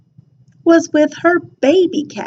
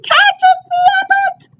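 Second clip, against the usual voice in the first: shouted. A narrator's reading. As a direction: shout up at him to catch it. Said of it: "They pointed up toward the egg and shouted".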